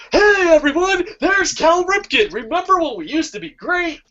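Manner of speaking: very high, hammed-up cheery announcement; mock excitement. Losing team showing off its old star, mock-excited. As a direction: drag out the high-pitched fake enthusiasm.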